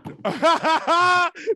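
big loud laugh; loud burst of laughter. Real, not acted. Laughing out loud at the friend's joke. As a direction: crack up out loud.

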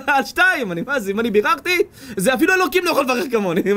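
high, pompous self-important preacher voice with laughs; mocking a conceited man who hands out blessings, pompous; boastful blesser. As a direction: ham up a braggart offering blessings.

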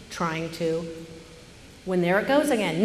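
ordinary delivery

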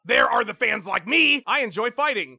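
ordinary delivery

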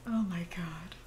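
breathy whisper. Real, not acted. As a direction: whisper it in disbelief. Whispered exasperation.

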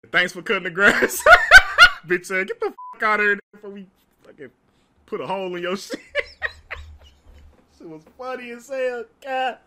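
loud, breaking into laughter mid-line; laughing retelling. Real, not acted. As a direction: barely keep it together. Dad's reply, laughing.

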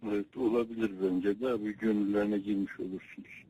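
thin, distant phone-line voice; over a landline phone. Real, not acted. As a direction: heard through the receiver. Other end of a phone call.

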